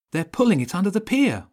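high, fast, loud shout. A narrator's reading. Boy warns the gang the boat is going under, shouting. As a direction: shout it out urgently at a high pitch. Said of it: "Wilf shouted".

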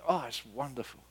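ordinary delivery

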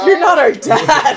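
high, loud, slow wail through laughter; laughing retold cry of alarm; mock freaked out. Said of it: "we were all really freaked out".

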